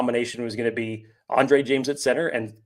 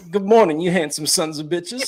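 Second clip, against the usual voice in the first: high, loud Grease-era greaser impression; hammed-up put-on movie-star voice, pitched up; John Travolta; 6 semitones higher, 4 dB louder than usual. Host doing a requested Travolta greeting for his co-host, hammed-up impression. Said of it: "Okay, John Travolta".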